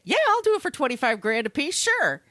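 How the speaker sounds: a hint of a high, quick country yokel. Ex-con agreeing to a job, lightly voiced yokel. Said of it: "He's a yokel".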